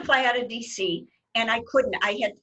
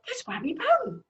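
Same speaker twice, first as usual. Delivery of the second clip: passerby; 12 semitones higher than usual. Retold or imitated. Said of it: "people would walk by and go".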